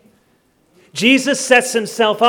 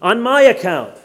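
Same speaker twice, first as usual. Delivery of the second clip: loud, raised declaration of the verse; emphatic scripture reading; 3 semitones higher, 6 dB louder than usual. Acted. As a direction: proclaim it firmly with a falling close. Reads verse, then questions it.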